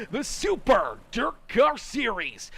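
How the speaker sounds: big, drawn-out announcer shout; announcer call. Real, not acted. Track announcer billing the series to the crowd, big announcer call. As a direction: belt out the series name slowly.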